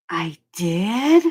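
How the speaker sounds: mock stunned question, voice rising at the end; sheepish surprise. Customer answering the Chicago guy's accusation, mock surprised. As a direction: retell a bewildered little question. Retold stunned reply to his scolding.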